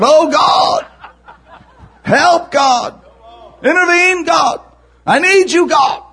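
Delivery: loud, high, desperate cries to God; pleading in a panic like running to the prayer closet; frantic praying. Retold or imitated. Worshipper in sudden trouble cries out loud to God, frantic.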